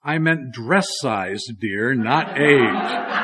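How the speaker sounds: slow, deliberate punchline; dress size correction. Acted. Wife correcting her husband, slow and pointed. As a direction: drag out the correction, word by word.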